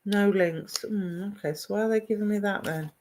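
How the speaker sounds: ordinary talk, nothing marked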